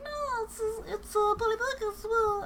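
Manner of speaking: high silly put-on voice; tweet voiced as character. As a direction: give the tweet a goofy squeaky voice. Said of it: "this one's like".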